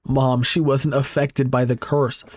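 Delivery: ordinary delivery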